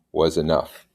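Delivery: ordinary delivery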